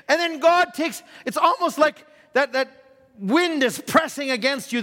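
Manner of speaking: building preacher's intensity; preaching. Acted. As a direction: press the words like wind. Preaching on trials.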